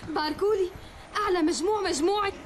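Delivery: excited. Acted. Announces top exam results.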